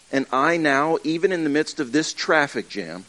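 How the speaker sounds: ordinary delivery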